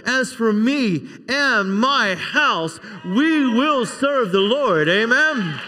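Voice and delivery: slow, deliberate preaching cadence; emphatic scripture declaration. Real, not acted. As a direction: proclaim it slowly, word by word. Preacher declaiming verse as climax.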